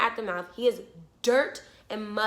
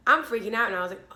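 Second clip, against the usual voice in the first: ordinary delivery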